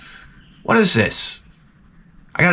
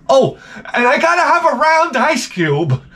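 mocking fussy guest; 11 semitones higher than usual.

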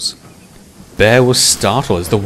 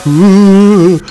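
high, loud, long-drawn wail; spooky hooting, vowels stretched out; eerie spooky ghost; 13 semitones higher, 11 dB louder, much slower than usual. A narrator's reading. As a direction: wail it slowly like a mock ghost. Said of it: "making eerie, spooky noises".

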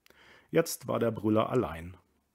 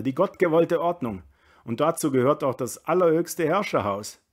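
raised, higher, emphatic voice; ranting reactionary burgher; 6 semitones higher, 9 dB louder than usual. A narrator's reading. Dierriegel lectures Kammerlander on the lost order, indignant. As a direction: build an indignant tirade, stressing key words hard.

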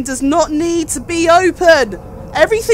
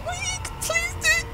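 high pleading; 9 dB quieter than usual. Real, not acted.

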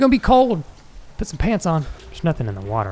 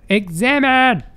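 shouted, higher and louder; 9 semitones higher, 4 dB louder, slower than usual. Shown as a demonstration. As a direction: bark the command out. Shouts the prompt a second time.

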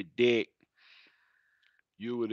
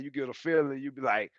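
normal talking voice

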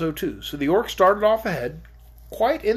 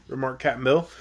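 plain reading voice

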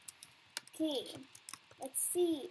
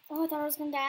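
normal talking voice

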